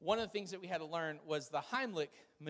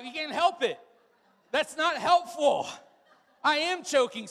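higher, louder whiny protest, slowed; mock pleading protest; 9 semitones higher, 11 dB louder than usual. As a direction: complain loudly that it doesn't help. Class pleading as choking victim.

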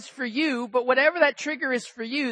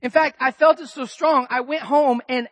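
ordinary delivery

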